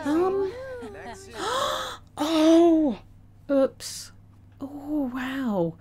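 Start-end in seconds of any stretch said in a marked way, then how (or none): 0.6-2.1 sharp high intake of breath; a high startled gasp; high shocked gasp. Real, not acted. Shock at sim event.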